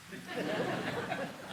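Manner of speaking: high-pitched laugh. Real, not acted. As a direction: burst out laughing at the punchline. Laughing at own punchline.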